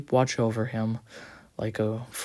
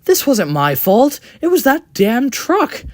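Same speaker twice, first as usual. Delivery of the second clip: loud, high angry shout; 13 semitones higher, 10 dB louder than usual. Acted. Thomas shouts at the coach, loud and high. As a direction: yell the blame away at full force. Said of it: "loud voices shouting".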